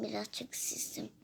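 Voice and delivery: soft pretend-sick whisper; changed sick voice. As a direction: whisper it like a child with a sore throat. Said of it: "sesim değişmiş". (kısık hasta fısıltısı)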